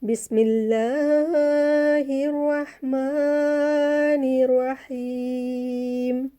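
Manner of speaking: slow, drawn-out chanted recitation; measured tajweed chant; Quran recitation. Shown as a demonstration. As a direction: recite with long held vowels.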